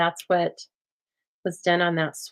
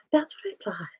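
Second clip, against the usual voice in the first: whispered aside; 3 semitones higher, 5 dB quieter than usual. Real, not acted. Muttered aside after a fail.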